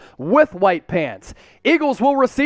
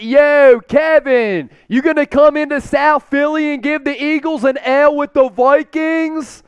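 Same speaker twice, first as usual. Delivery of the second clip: put-on a Delco accent, higher and pushy; 4 semitones higher than usual. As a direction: tease the coach in a Delco accent. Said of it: "That's my best Delco accent".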